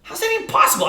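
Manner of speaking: high, disbelieving cry; shocked disbelief. Retold or imitated. Telling the coach's news to traders, high disbelieving cry. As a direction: sound floored and amazed. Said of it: "I'm like".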